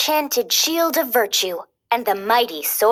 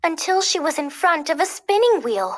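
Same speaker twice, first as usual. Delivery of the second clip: plain reading voice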